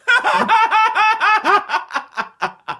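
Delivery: high cackling laugh, tapering off; long laugh. Real, not acted. Actress laughing at getting on the list, long high cackle. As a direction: burst into rapid high laughter.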